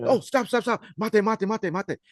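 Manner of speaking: high urgent ref call; referee calling stop. Retold or imitated. Referee stops the fight on the mat, high calling voice. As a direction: halt the match like a referee. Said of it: "The ref's like".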